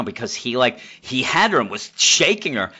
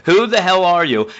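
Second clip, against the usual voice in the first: higher, louder challenge; sharp demand; 6 semitones higher, 6 dB louder than usual. Retold or imitated. Catwoman challenging the officer who saved her, higher and louder. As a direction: demand it pointedly. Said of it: "And she says".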